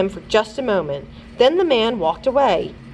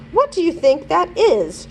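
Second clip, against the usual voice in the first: yelled; 9 semitones higher than usual. A narrator's reading. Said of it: "she yelled".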